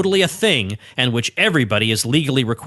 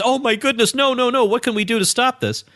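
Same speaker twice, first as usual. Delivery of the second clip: high-pitched, hammed-up alarm; mock panic; 10 semitones higher than usual. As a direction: plead in pretend distress. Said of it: "what he's expecting me to say".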